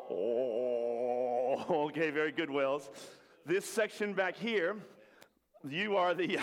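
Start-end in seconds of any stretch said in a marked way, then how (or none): 0.1-1.9 low, long droning whale moan; sustained low whale "oh"; whale call. Shown as a demonstration. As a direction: lead the group in a deep whale drone. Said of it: "Let's hear the whales".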